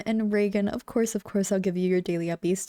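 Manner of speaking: ordinary talk, nothing marked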